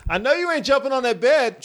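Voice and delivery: high-pitched mock dad scolding; play-acted parental telling-off; scolding daddy. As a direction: call out like a dad catching the kids. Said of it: "Watch me be a daddy right now".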